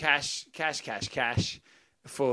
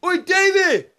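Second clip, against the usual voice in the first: high, loud mock backpacker yell; imitating a needlessly loud shout; 7 dB louder, slower than usual. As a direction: holler a name as if across a street. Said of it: "still feel the need to yell".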